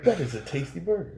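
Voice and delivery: high, hammed-up movie-gangster line; Pulp Fiction gangster. Friend on podcast trading Pulp Fiction lines, mock-menacing. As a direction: ham up a tough guy savouring a burger. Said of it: "Pulp Fiction".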